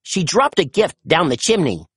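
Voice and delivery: ordinary delivery, nothing marked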